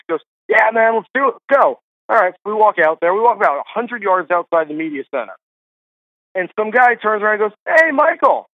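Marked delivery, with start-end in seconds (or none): 0.5-1.8 higher-pitched, upbeat imitation of a game friend; eager agreement. Colleague agreeing to a walk on the course, retold eagerly. 7.7-8.5 high-pitched mock hail; imitated calling out. Stranger hailing a broadcaster outside the media center, high imitated call.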